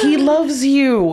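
high, slow, reassuring coo; soothing mom. Retold or imitated. Mom reassuring the crying nanny, slow and soothing. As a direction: comfort her gently, stretching each word. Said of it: "And she's like".

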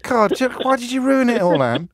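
high-pitched, playful protest; hammed-up exasperation; mock dismay. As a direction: wail in comic outrage at a spoiled gag. Caller spoils his song gag.

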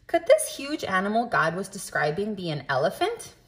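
usual speaking voice

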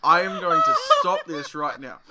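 loud, high exasperated protest; playful outrage. Real, not acted. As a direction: put your foot down loudly over the laughter. Mock outrage at Australian stereotypes.

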